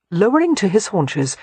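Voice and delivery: ordinary delivery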